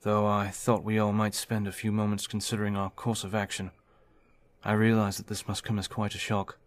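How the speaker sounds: a steady officer taking charge, projecting to the room; David, firm team leader. Acted. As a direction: lay out the plan like a confident commander.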